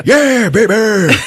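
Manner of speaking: high, loud, drawn-out cheer; hammed-up glee; triumphant mock whoop. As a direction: whoop as if blasting a flamethrower. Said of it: "And he's like".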